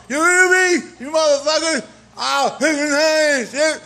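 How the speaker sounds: ordinary talk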